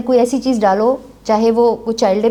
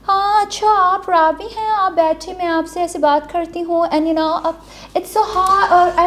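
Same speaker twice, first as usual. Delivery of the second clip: stylish showbiz lady; 6 semitones higher, slower than usual. Retold or imitated. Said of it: "وہ ایسے بول رہی تھیں".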